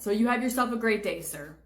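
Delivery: ordinary talk, nothing marked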